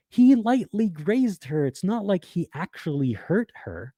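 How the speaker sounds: high, slow, sneering imitation of the commenter; mock the defender in a high, drawn-out voice. Host mimics the commenter who excuses the shove, mockingly. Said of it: "this commenter right here saying".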